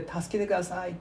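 ordinary delivery

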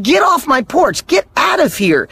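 high, slow, emphatic brush-off; mock irritated dismissal. Householder to door-to-door book salesman, mock irritated. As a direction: send a pest packing, acted annoyance.

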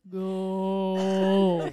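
deep voice. Shown as a demonstration. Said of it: "Go lower".

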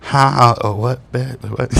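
imitating a dazed, stoned reply. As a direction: act out being too high to follow. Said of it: "I was fucking high".

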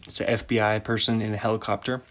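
usual speaking voice

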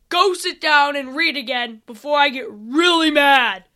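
loud, angry order; yelling. Acted. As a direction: yell him back into his seat. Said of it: "as he yelled at the creature".

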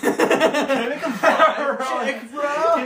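very high, loud, drawn-out clowning; hammed-up squeal through laughter; mock pleading. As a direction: stretch it out high and loud, joking. Mock echo of insight request.

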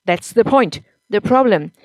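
husband interrupting his wife angrily; angry voice. A narrator's reading. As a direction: cut her off, angry and loud. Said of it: "with an angry voice".

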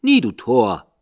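loud harsh raven reply; raven's retort. A narrator's reading. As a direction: snap back as the raven. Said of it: "Sprach der Rabe".

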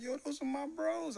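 high, slow exclaim; high, drawn-out happy yo; excited reply. Retold or imitated. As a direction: savor the surprise.

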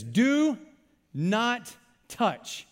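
"Do" drawn out high and loud, pause, then "not touch"; emphatic sign reading. Acted. Preacher reads a warning sign to the congregation, "Do not touch" emphatically spaced. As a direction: land "Do", "not", "touch" as separate weighty words. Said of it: "and it says".

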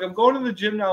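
high, worked-up retelling; mock bawling. Man retelling his answer to his best friend, mock tearful. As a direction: replay being upset, pitched high. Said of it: "I was bawling my eyes out".

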